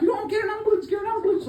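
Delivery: high, frantic yelling for help; crowd screaming. Retold or imitated. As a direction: shout it like a panicked crowd. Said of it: "all screaming".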